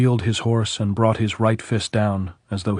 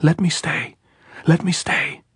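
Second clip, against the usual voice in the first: pleading cry, climbing higher on the repeat; begging from the saddle, higher each time; desperate shout; 8 semitones higher than usual. A narrator's reading. Maria begs Robert to let her stay, rising pleading shout. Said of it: "Maria turned and shouted".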